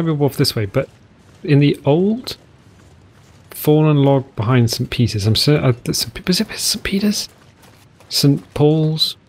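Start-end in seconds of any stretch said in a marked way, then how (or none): 6.3-7.3 quick whispered aside; whispering. Real, not acted. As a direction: ask it under your breath.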